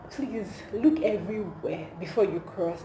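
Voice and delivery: normal talking voice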